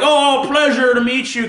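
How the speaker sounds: mock starstruck greeting. Fan greeting someone famous, mock delight. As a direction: lightly parody a thrilled greeter. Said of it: "If you're meeting someone like that".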